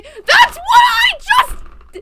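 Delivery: high, drawn-out yell of protest; exasperated shout. Real, not acted. Player protesting at the game, exasperated shout. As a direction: shout it slowly in loud disbelief. Outburst at the game's dialogue.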